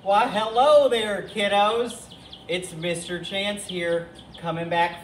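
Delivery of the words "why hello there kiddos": loud, higher-pitched hearty greeting; hearty hailing. Pretend. Host greets the kiddos and Bobby, hearty hailing. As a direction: call out a big hello to the crowd.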